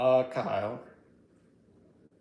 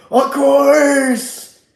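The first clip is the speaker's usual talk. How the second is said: much higher, shouted mock rant; mock Cenk yell; 12 semitones higher, 15 dB louder than usual. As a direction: ham up a furious yelling pundit. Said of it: "he was yelling all the time".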